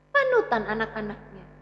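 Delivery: ordinary delivery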